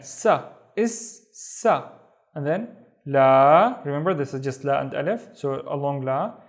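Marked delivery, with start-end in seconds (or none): 0.0-3.8 is sa, la drawn out slowly; slow syllable build. Shown as a demonstration. Teacher building ma'a salama slowly. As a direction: stretch each syllable. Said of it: "we read the sa again".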